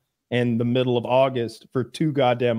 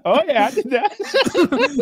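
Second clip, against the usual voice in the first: slow, much higher, laughing send-up; mock laughing boast; 13 semitones higher, much slower than usual. Friend mockingly echoes the ex-con's boast, laughing. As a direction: ham it up as a cheeky boast.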